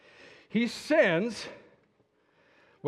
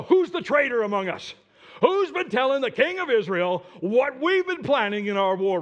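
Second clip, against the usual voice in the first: loud, higher, accusing king; angry demand; 7 semitones higher, 6 dB louder than usual. Acted. Enemy king demanding the traitor from his officers, loud and angry. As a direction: bark it at the officers like a furious king.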